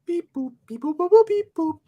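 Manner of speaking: high, sing-song robot beeping; robot beeps. Pretend. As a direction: chirp it like a toy robot. Beeping joke.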